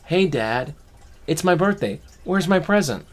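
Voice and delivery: put-on high, loud cheeky kid demanding a gift; cheeky kid joke. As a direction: tease Dad like a bratty child. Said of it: "I think I'll be funny".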